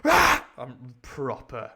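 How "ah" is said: loud, high yell; short explosive battle cry; attacking cry. Acted. Silver attacking Tom, loud acted cry. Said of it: "With a cry".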